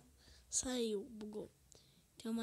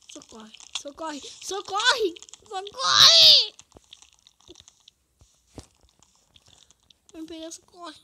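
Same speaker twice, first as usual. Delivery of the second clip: screaming for help, rising to shrieks; panic, building to full screams; panicking gamer; 11 semitones higher, 17 dB louder, slower than usual. Real, not acted. Chased in Minecraft, screaming.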